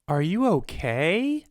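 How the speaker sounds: slow, high, exaggerated lilting question; lady customer. Seller mimics the lady who asked about him, mock concern.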